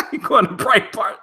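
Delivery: high laughing disbelief. Real, not acted. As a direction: laugh through the words at the joke. Laughing at friend's joke.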